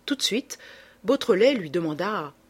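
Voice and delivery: plain reading voice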